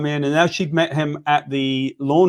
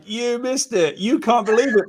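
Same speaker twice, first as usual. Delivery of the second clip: mock bragging; 7 semitones higher than usual. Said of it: "you were like".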